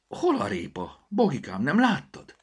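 high-pitched, worried questioning; alarmed questioning. A narrator's reading. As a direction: ask urgently where it has gone. Mother finds the carrots missing.

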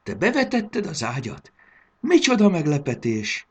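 high, bright-voiced delighted praise; grandma praising her grandson, delighted; delighted surprise. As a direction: gush with joyful surprise. Grandma delighted by made bed.

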